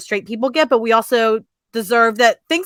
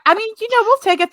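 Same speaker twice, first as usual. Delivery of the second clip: higher, wry shrug of a line; wry acceptance; 12 semitones higher than usual. Real, not acted. Host wryly thanking a streaming service, pitched up. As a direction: grudgingly accept it, amused.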